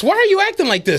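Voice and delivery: high, quick, disbelieving; incredulous outburst. Retold or imitated. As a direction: throw the question up in disbelief. Incredulous retold reply.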